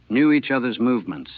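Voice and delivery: ordinary delivery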